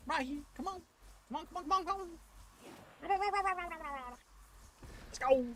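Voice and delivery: taunting. Pretend. Taunting the boss in voice.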